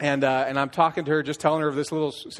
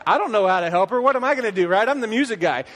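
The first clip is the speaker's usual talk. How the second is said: higher and louder, mock panic rising; freaking out; 6 semitones higher, 4 dB louder, faster than usual. Worship leader retelling his panic about helping her, higher and louder. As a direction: replay the panic, voice climbing. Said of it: "I start freaking out".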